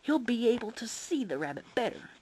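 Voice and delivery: put-on high protesting child; crying out. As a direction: plead it like a little kid. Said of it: "Toby cried".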